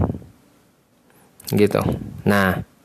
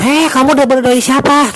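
much higher, louder, sharp scolding yell; mock scolding parent; 13 semitones higher, 7 dB louder than usual. Parent snapping at a curious child, mock shrill scolding. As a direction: shriek at the kid like a harsh parent.

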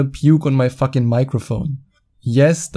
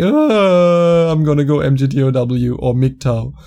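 whiny rejected guy. Retold or imitated. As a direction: moan it like a self-pitying loser. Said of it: "you say".

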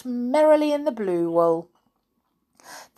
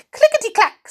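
high, bright clicking voice; needles' voice; 6 dB louder, faster than usual. A narrator's reading. The magic needles answering Sally, high and clicky. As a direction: chirp it like busy needles. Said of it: "said the busy needles".

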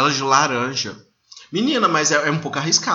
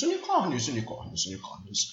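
dreamy repetition trailing into near silence; fading echo; 3 semitones lower, 7 dB quieter than usual. Pretend. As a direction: repeat it like an echo dying away. Dreamy repeated word, fading out.

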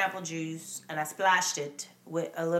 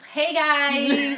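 YouTuber greeting; 7 semitones higher, 8 dB louder than usual. Acted. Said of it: "we gotta do the".

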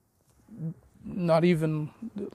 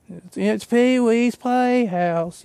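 Pee Wee Herman voice; 4 semitones higher, 5 dB louder, much slower than usual. Retold or imitated.

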